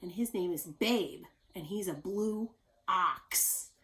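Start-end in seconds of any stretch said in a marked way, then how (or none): 1.5-3.7 ox stretched slowly to show the ending. Shown as a demonstration. Teacher highlighting the X word for the class, slow. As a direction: linger on blue ox. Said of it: "ox is a word that also ends with X".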